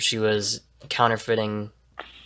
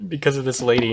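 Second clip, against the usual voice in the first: ordinary delivery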